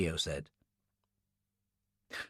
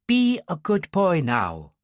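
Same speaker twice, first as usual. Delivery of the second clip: slow, higher Irish lilt; Irish accent; 4 semitones higher, slower than usual. Acted. Woman with a gun hushes an altar boy, Irish accent. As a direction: coax a child in an Irish accent. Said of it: "she said in an Irish accent".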